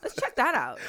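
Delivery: loud, cheery mock eagerness; blissfully march toward danger; mock eager knights. Retelling knights' reaction.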